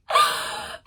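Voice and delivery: giddy high gasp of laughter; high squealing gasp. Real, not acted. Swooning over book line.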